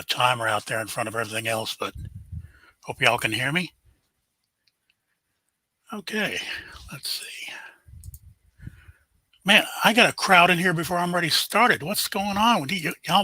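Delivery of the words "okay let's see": quiet whisper while setting up; muttered whisper under the breath; whispered to self. Real, not acted. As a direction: whisper it to yourself, busy with the tech. Muttering to himself before starting.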